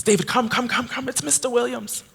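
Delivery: high, eager mother calling her son over; mother calling David over, high and eager; excited mother. Retold or imitated. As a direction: hurry him over, brimming with excitement. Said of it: "She goes".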